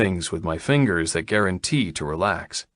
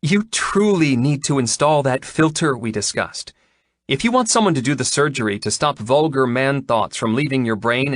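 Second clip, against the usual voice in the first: higher-pitched, mildly irritated woman; 6 semitones higher than usual. A narrator's reading. Vet scolds a crude client, irritated. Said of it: "irritation bright in her gaze".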